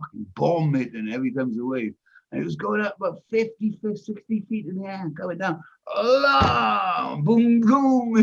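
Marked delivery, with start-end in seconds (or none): 6.3-8.2 loud, high crash noises; imitated crashing booms. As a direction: boom it out like a hull slamming on waves. Imitates boat crashing down into waves.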